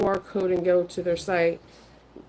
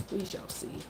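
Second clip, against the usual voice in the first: whispering; 2 semitones lower, 13 dB quieter than usual. Real, not acted. Quiet aside about pneumonia.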